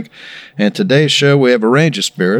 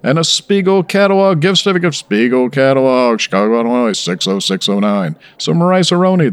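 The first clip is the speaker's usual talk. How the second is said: Don Pardo; 3 semitones higher than usual. Retold or imitated. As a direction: boom the prizes like a host. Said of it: "Now that's Don Pardo".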